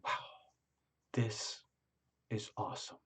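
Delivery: very quietly. Retold or imitated. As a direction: keep it to yourself, barely audible. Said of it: "very quietly going like this".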